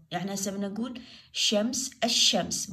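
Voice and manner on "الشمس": الشمس with the L silent and a doubled sh; silent lam. Shown as a demonstration. Teacher shows pupils a sun letter, lam silent. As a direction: say الشمس as "ash-shams", no L. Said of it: "باع اللام ما لفظتها".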